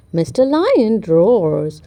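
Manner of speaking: ordinary delivery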